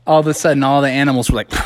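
ordinary delivery

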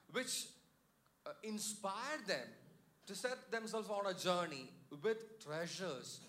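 building to a fervent climax; full-force preaching. Acted. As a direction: preach it out to the congregation.

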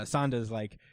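usual speaking voice

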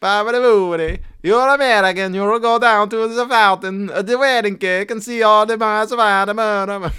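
mock Italian guide giving directions; mock Italian accent. Imitating the Rome woman with a mock Italian accent. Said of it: "did they throw a bobbity boobity".

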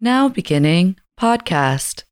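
measured automated transit announcer, spaced out; transportation lady. Shown as a demonstration. Co-host demonstrates a train announcement, transportation lady voice. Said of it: "Transportation lady voice".

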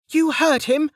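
high-pitched battle shout. A narrator's reading. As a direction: yell it at the troops as an order. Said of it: "Lin shouted".

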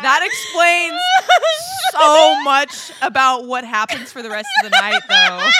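high, loud laughing words. Real, not acted. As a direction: laugh through it. Laughing at drunk memo.

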